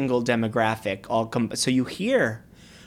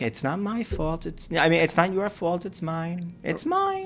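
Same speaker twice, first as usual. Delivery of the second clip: martyr Long Island voice; 7 semitones higher than usual. Shown as a demonstration. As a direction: play the little martyr, pitch up on mine. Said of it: "It's a little masochistic".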